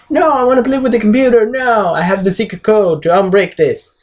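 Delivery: put-on high, fast whiny kid protest; small child. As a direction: throw a mock tantrum like a little kid. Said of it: "having the Batman like a small child".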